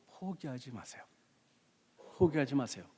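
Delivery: soft, repeated urging after a pause; hushed earnest appeal. Real, not acted. Preacher urging the congregation not to give up, hushed and earnest.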